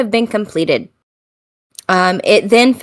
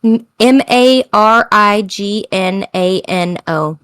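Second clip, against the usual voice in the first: usual speaking voice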